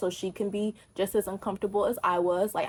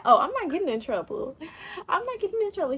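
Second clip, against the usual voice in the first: high, giddy, laughing; gleeful relief; 10 semitones higher than usual. Retold or imitated. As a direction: sing it out in relief. Relieved joy retold.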